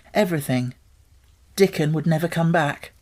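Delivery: ordinary delivery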